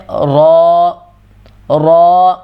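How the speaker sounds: رو full and heavy with rounded lips; رو thick and rounded. Shown as a demonstration. Teacher showing heavy ر to students. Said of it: "green colour ہے تو اسے ہم نے موٹا کر دینا".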